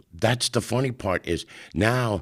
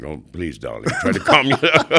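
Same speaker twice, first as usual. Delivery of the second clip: camp coaxing breaking into laughter, higher and louder; Clement soothing, laughing; 6 semitones higher, 6 dB louder than usual. Retold or imitated. Clement calming his loud partner, laughing. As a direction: laugh through a soothing darling. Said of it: "Clement's like".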